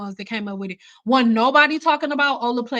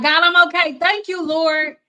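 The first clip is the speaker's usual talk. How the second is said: higher, louder joyful thank-yous; relieved thanks to God; 6 semitones higher, 4 dB louder than usual. Retold or imitated. Stylist dancing after rent is covered, higher joyful thanks. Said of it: "And I'm dancing like".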